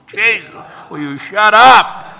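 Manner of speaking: loud, slow, fed-up outburst; exasperated yell. Retold or imitated. Fed up with a stranger's endless story, shouts loud and slow. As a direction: blurt it out at the rambling stranger.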